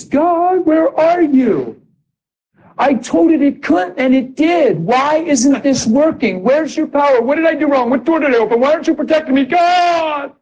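high-pitched pleading wail to God; desperate cry. Acted. Preacher acting out a believer's panicked prayer, desperate cry to God. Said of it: "gonna be a desperate cry".

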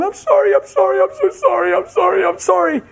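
high, crying repeated sorry; desperate, tearful sorry; frantic apology. Retold or imitated. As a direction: plead over and over, near tears. Wife's frantic apology acted out.

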